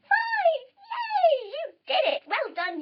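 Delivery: high, loud hurrah; squealing high, then settling praise; celebratory cheer. Acted. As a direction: cheer the kids on in triumph. Cheers the children's success.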